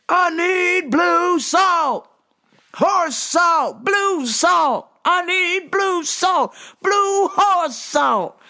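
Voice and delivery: mock customer yell, high and drawn out; imitated yelling. Customer to worker four feet away, yelling. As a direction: bellow each word like he can't hear himself. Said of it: "but then would yell".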